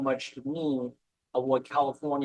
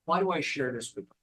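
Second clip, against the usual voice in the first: normal talking voice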